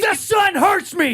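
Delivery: high, loud, drawn-out complaint; exasperated yell. Real, not acted. Swimming hater defending himself to co-host, exasperated yell. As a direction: wail it slowly at full volume. Comic outburst against swimming.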